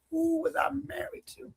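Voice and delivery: slow, breathless whispered question; stunned whisper. Retold or imitated. Hearing the truth, a slow shocked whisper.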